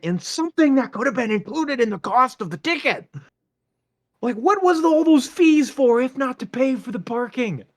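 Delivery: high, loud ranting, climbing to a yell; retold yelling at the parking setup; furious rant. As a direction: vent it all at the organisers.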